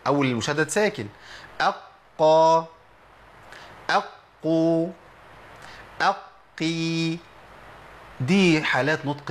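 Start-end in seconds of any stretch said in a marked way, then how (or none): 1.6-7.2 أقى أقو أقي, each held long and slow; doubled qaf demo. Shown as a demonstration. Teacher drawing out each doubled qaf for students. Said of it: "في حاله تشديد هندخل عليه همزه".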